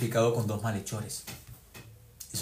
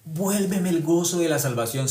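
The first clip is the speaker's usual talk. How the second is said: pleading prayer; 6 semitones higher, 6 dB louder, slower than usual. Retold or imitated. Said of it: "Él le pidió a Dios".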